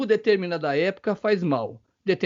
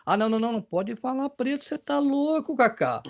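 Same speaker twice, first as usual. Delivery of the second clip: higher-pitched imitation of a scolding objector; mock scandalized objection; 6 semitones higher than usual. Host imitating people who object to the word, mock scandalized. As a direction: act out people protesting in a raised pitch.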